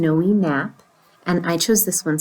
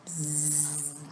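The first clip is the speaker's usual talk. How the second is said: soft buzzing fly noise; 5 semitones lower, 19 dB quieter than usual. Acted. Storyteller shows the flies round the pigeon, soft buzzing. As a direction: imitate a quiet little fly.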